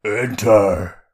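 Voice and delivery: loud booming command from afar; giant alien booming voice. A narrator's reading. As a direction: boom it out like a giant. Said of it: "A deep voice boomed from inside".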